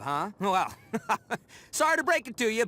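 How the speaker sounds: ordinary talk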